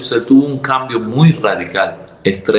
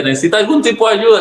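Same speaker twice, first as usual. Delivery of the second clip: fast and much higher; quick official question; 9 semitones higher, much faster than usual. Retold or imitated. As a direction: rattle it off like a clerk. Imita a la señora de embajada. (pregunta rápida y aguda de funcionaria)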